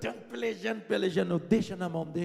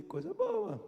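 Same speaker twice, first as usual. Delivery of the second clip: high, light "Coisa boa"; feigned casual "coisa boa"; 12 semitones higher, slower than usual. Retold or imitated. Son answering his mother's church news, high and light. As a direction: toss it off lightly, pitched up.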